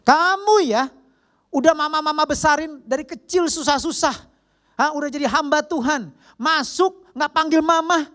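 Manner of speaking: imitated scolding mom, high and loud; hammed-up motherly telling-off, pitched high; nagging mother. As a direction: nag like an offended mother telling off her child. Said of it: "mama saya langsung ngomel".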